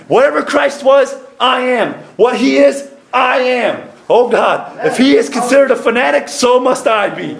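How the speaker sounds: high, insistent declaration in short bursts; full-force preaching. Real, not acted. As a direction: proclaim it boldly to the church.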